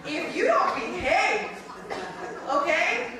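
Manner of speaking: high, slow menacing threat; mock threat. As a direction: threaten like a furious mom. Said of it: "It was".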